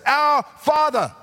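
slow, lifted "Our Father" like a prayer; prayer demo. Shown as a demonstration. Preacher showing the congregation how to pray, slow and high. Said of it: "pray like this".

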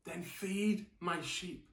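a gentle smiling Jesus, spoken low and slow. Retold or imitated. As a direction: say it with a smile, tenderly. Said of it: "You can imagine Jesus smiling".

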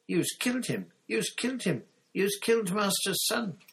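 very high, frantic elf voice; distraught elf wail. A narrator's reading. Winky flinging herself on her master, high frantic wail. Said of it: "She flung herself forwards".

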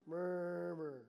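slow, low, drawn-out drone; mock murmuring. Shown as a demonstration. Preacher names what you're doing, slow low mock murmur. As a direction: drone it low, stretching the word. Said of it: "we know what you're doing".

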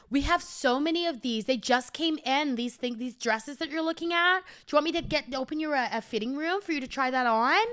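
high, loud, fast gushing salesperson; bubbly clerk pressing to help; over-eager American sales patter; pushy cheerful shop assistant. Retold or imitated.

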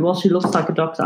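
ordinary talk, nothing marked

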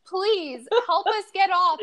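high, loud pleading cry; retold yell for help, high; mock scream. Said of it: "Estee's screaming to the conductor".

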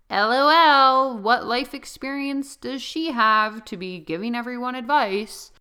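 mocking gossip. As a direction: sneer like a snarky gossip. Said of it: "I was hearing it through the grapevine, like".

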